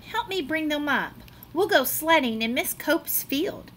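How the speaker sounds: ordinary delivery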